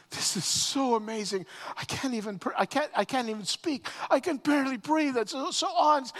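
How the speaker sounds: overwhelmed witness losing words to wonder; awestruck. Acted. Paul watching the vision, breathless and awestruck. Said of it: "awestruck, speechless".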